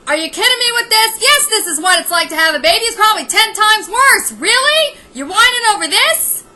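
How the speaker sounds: loud exasperated disbelief; exasperated rant. Real, not acted. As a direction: scoff at the whining, loud and quick. Ranting at Kim's complaining.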